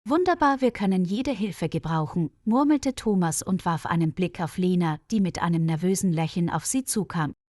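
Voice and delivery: plain reading voice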